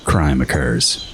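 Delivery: low, slow trailer voice; deep dramatic trailer read; grand booming space narration. Pretend. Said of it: "Say it like I don't remember it".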